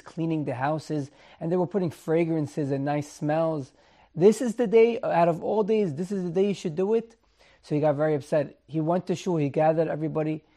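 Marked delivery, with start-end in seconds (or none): 4.1-7.2 higher and louder, a touch of outrage; indignant rebuke. Retold or imitated. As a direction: scold them in disbelief. Upset rabbi rebukes the women.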